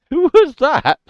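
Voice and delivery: loud startled high question; shocked who was that; surprised. Real, not acted. Startled by unknown voice.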